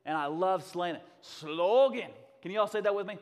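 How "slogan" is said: "Slogan" with long, loud Scottish O. Shown as a demonstration. Preacher giving a Scottish lesson, "Slogan" with drawn-out Scottish O. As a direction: stretch the O in "Slogan" Scottish-style, clip the end. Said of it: "That's your first Scottish lesson".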